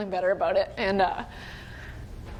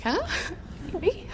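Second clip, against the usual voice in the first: imitated stunned disbelief; 8 semitones higher than usual. Player told by coach she's going in, imitating stunned surprise. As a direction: act caught off guard, half laughing.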